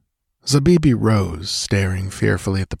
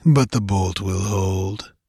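slow, dry scorn; measured, understated mockery; sardonic; slower than usual. A narrator's reading. Priest assures that the barbarian cannot get through, sardonic. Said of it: "said Totrasmek sardonically".

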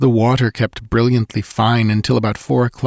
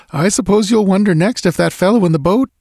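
much higher, forced jokey tone; nervous banter; 11 semitones higher, 4 dB louder than usual. Acted. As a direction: brush it off with a forced joke. Nervous joking, then breaks off.

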